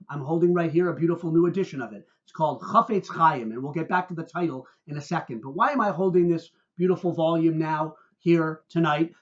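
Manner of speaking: ordinary delivery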